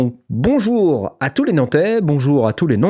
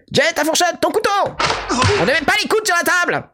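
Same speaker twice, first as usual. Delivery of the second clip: high, fast scolding nanny voice; scolding nurse; faster than usual. Pretend. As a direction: chide like a strict carer. Scolding Jay at table.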